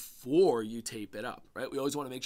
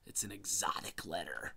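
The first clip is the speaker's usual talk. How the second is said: lower, secretive whisper; mysterious whisper; 7 semitones lower, 5 dB quieter than usual. Acted. Teacher whispering to children about a mystery letter, lower and secretive. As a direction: hush it like a secret.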